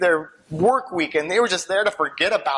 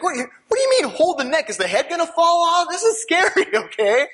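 mock panic; 9 semitones higher than usual. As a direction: play up the panic for laughs. Mock panic about holding babies.

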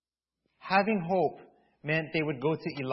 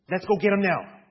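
urgent rallying; 6 semitones higher, faster than usual. Retold or imitated. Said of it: "all like".

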